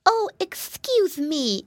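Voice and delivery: high, drawn-out piglet apology; slow exclamation in a little-pig voice; exclaiming piglet. Acted. Flop apologizes to the mouse, high exclaiming voice. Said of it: "exclaimed Flop".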